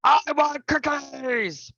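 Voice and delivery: high, slow, whiny I want cookies; demanding child. Shown as a demonstration. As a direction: demand it like a spoiled kid. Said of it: "Different tone".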